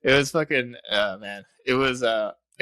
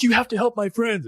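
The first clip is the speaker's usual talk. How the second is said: pleading lady; 10 semitones higher than usual. Retold or imitated. Pleading lady voiced in retelling.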